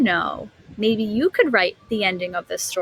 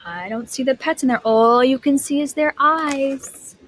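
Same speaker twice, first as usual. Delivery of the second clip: ordinary delivery, nothing marked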